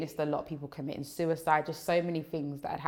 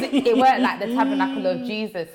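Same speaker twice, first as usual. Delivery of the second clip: laughing; 7 semitones higher, 7 dB louder than usual. Real, not acted. Laughing about church name.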